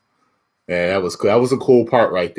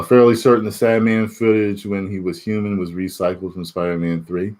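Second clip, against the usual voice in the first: ordinary talk, nothing marked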